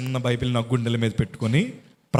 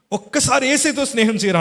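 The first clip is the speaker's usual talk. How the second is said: emphatic preached repeat; 11 semitones higher, 4 dB louder than usual. Retold or imitated. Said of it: "అన్నారు".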